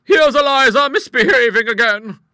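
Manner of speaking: very loud, high-pitched call; shout. Acted. Mistress calling her nephew to the snake, shouting high. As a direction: shout it across the house. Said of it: "she shouted".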